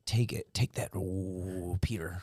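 hushed egging on; whispering. Real, not acted. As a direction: whisper him through it. Egging friend on mid-chug.

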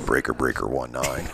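a hint of a low CB radio trucker; just a touch of CB trucker radio talk; lightly voiced trucker. Hearing himself in headphones, a hint of CB trucker. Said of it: "it's just like".

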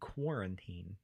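Quarantine spoken slowly, every syllable crisp; enunciating. Shown as a demonstration. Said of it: "I was enunciating".

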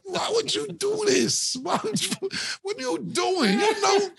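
high, slow, pained disbelief; mock exasperated LeBron. Mimicking LeBron scolding J R Smith, high and exasperated. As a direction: plead in a high strained voice, dragging it out. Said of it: "I'm LeBron".

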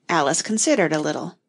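usual speaking voice

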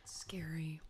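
soft unvoiced whisper. Real, not acted. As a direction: whisper it as an aside. Whispered aside.

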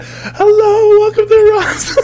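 shrieking falsetto welcome, hugely exaggerated; absurdly high, loud store greeting; over-friendly greeter. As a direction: blow the friendliness up into a loud squeal. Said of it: "More friendly, more".

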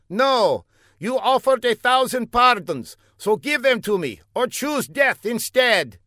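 high, pompous put-on foreign voice; a self-important spy explaining his mistake; high comic foreigner. A narrator's reading. Older man explains to Fla, high and pompous.

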